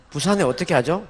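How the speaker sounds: ordinary delivery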